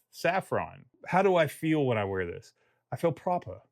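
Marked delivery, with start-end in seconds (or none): 2.9-3.6 put-on a light English accent, higher; playful English accent. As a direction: say it like a proper English gent. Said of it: "stop doing the, the English accent".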